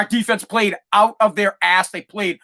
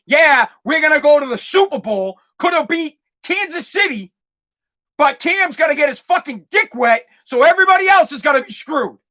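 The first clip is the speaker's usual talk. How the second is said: hammed-up shout, pitched higher; mock fan rant; 6 semitones higher than usual. Mock quote of hopeful then ruined fans.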